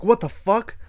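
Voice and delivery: high, alarmed what the fuck; startled exclamation. Retold or imitated. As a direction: caught off guard by the killer. Dude sees glitchy killer.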